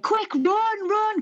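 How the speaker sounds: high-pitched retold alarm; panicked urging. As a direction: imitate friends yelping to flee. Friends urging escape before being thrown out.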